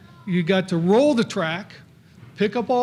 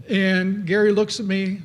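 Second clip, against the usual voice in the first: ordinary delivery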